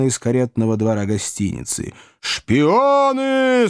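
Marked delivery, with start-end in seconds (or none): 2.2-3.7 very high, stretched-out roar; mob roar. A narrator's reading. Crowd accusing the Pickwickians, high roaring cry. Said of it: "снова заревела толпа".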